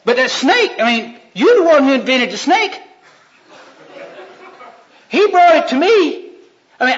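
high, loud, laughing excuses; flustered Eve. Acted. Eve laughing nervously as she blames God's snake.

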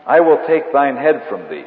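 loud, ringing King James defiance; mock biblical prophet. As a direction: thunder it like a warrior prophet. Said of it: "David says to the Philistine".

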